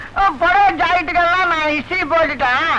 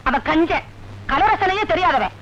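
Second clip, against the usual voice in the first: ordinary delivery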